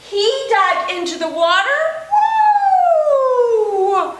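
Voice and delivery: bouncy, sing-song counting rhyme with whoops; playful children's finger-play chant; storytime action rhyme. Acted. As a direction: chant it for little kids doing the actions. Chants five perky penguins rhyme.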